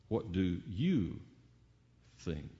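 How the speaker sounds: slow, low "you", pause before "think"; stress on YOU. Shown as a demonstration. Preacher singles out each listener, slowly stressing "you". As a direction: draw out "you", then drop "think" very softly. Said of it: "It's just you".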